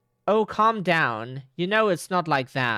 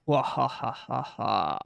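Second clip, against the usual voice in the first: demon laugh; 5 semitones lower than usual. Pretend. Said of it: "Probably some demon voice".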